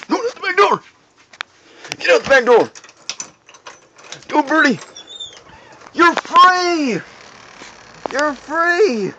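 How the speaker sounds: high, loud excited yelling; excited shouting. Real, not acted. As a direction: cheer it out the door. Bird finally escapes outside.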